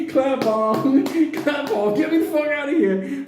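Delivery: high, giggly comic outburst; hammed-up frantic dog voice; laughing mock panic. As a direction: squeal it through laughter. Comic frantic dog trying to escape.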